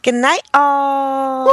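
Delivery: slow stretched goodbye yell; drawn-out sign-off shout. Real, not acted.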